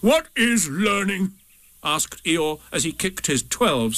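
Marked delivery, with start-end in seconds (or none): 0.0-1.3 slow, raised scornful question; bitter scorn. A narrator's reading. Eeyore kicking his sticks away, bitterly scornful. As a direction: draw it out in contempt.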